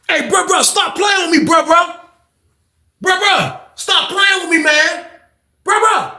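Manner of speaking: high yelling, stop playing with me; high-pitched pleading yell. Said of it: "He was like".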